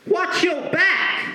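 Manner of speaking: high, slow yelled threat; high defiant kid yelling at the teacher; mock threat shout. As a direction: shout it like a kid talking back. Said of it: "Isabel goes".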